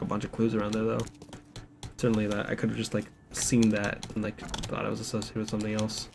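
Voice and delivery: ordinary delivery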